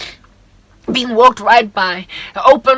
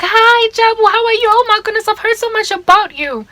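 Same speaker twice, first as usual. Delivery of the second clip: high, sugary mock greeting; gushing colleague; 11 semitones higher than usual. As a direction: gush like a fake-friendly colleague. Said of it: "they will likely be like".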